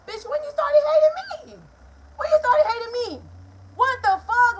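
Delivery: high, loud shocked outcry; can't believe what you just heard; real high, loud indignant shock; outraged disbelief. Real, not acted. Her shocked reaction to mom's words.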